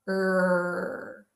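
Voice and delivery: sustained, isolated "Er"; R sound. Shown as a demonstration. Teacher shows class the tongue position, held R sound. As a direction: hold "Er" with the tongue pulled back. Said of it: "to make the R sound".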